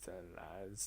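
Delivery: very soft, low delivery; quiet close-up catchphrase; how I put it. Shown as a demonstration. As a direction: say it hushed and low, almost under the breath. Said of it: "I say".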